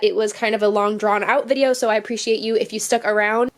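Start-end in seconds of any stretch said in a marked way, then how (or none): none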